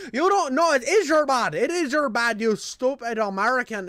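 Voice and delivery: high, loud mock Irish scolding; hammed-up Irish-accented outburst; irate Irishman impression. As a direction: tell someone off as an outraged Irishman. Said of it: "dude goes off on me".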